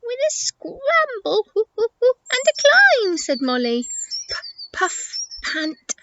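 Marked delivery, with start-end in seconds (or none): 0.0-3.2 very high monkey voice with hoots; excitable monkey. Acted. Monkey answering how she'll cross, high hooting voice. 4.3-5.9 breathy, softer puffed-out words; acted panting. Elephant struggling over rocks, puffing for breath.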